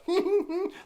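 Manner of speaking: high-pitched imitation giggle; imitated giggle. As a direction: mimic a weird high little laugh. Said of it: "does this real, like".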